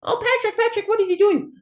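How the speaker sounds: very high, loud cartoon squeal; high-pitched SpongeBob panic. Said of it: "Oh, it's SpongeBob".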